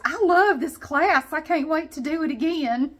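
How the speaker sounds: the lady thrilled at the end of class; imitating the lady's delight. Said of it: "She goes".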